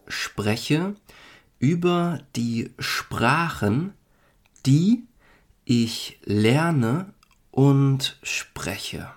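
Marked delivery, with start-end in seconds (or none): none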